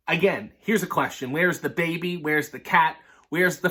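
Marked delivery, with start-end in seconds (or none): none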